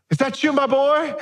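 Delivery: high, fake-sweet tone; sarcastic syrupy fatherly voice; mock sweetness. Preacher mocking Saul's fake affection for David. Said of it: "That just ticks me off".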